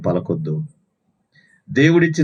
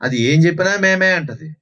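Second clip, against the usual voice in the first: a hint of a goat's bleat on మే మే; mock goat bleating; 7 semitones higher than usual. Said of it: "మేక తోకట్టుకుంటే మే అంటదంట".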